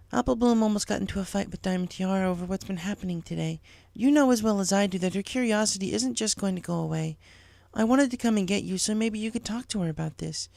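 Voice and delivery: a teacher needing help; lightly voiced teacher Cheerilee. Cheerilee asking Applejack to come to school, lightly voiced.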